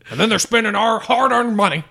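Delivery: high, hammed-up outraged pundit; mock a ranting talk show host. Continues mock pundit rant.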